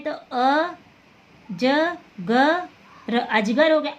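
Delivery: अ, ज, ग, र said one by one, then अजगर; spelled out syllables. Shown as a demonstration. Teacher breaking अजगर into letters. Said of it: "तो अ आएगा अजगर में".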